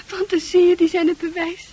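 normal talking voice

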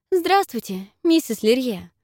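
anxious high greeting; nervous. A narrator's reading. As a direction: nervously greet a stern lady. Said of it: "отчаянно труся".

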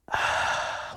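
long soft breath out; quiet exhale to calm down; slow calming sigh. Real, not acted. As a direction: let out a settling sigh.

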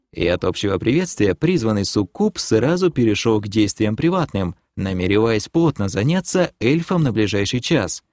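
ordinary delivery, nothing marked

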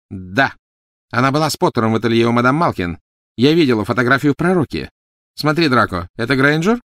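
quick, high, loud woman's voice; cold wife, urgent. Acted. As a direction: insist briskly, then demand confirmation. Voices Narcissa in the story. (высокий, резкий женский голос)

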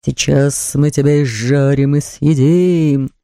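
slow, drawn-out menacing voice; sinister. A narrator's reading. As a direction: threaten like a horror-film monster. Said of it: "звучали у него в ушах зловещие фразы". (зловеще, медленно, нараспев)

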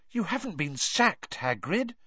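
shocked high gasp. A narrator's reading. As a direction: gasp it out in disbelief. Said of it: "gasped Hermione".

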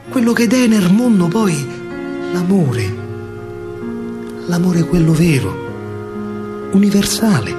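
normal talking voice

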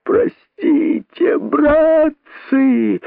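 very high slow howl; wailing plea. A narrator's reading. Rabbit begging the crowd, wailing. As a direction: wail for forgiveness. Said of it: "вопил находчивый".

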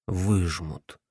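low, soft «Выжмут» to himself; quiet repetition. A narrator's reading. Repeating to himself.

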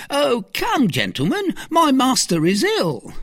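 very high, urgent servant voice; alarmed servant. Acted. Planchet calling the gentlemen, high and alarmed. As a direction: call for help in a high voice. Servant calls friends for help.

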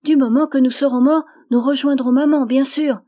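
put-on gleeful small girl, full of certainty; joyous child. A narrator's reading. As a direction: burst out with childish relief. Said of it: "fit-elle avec un rire joyeux".

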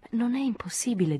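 hushed, tentative objection; calm reply. A narrator's reading. Calm reply to a shouting woman.

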